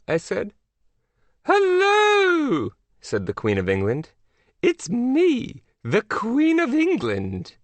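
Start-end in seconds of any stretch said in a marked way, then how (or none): 1.4-2.7 very high, drawn-out regal greeting; hammed-up British royal hello; Queen of England. A narrator's reading. 4.6-7.5 high, grand British royal voice; hammed-up posh monarch; Queen of England. A narrator's reading. Queen of England introduces herself.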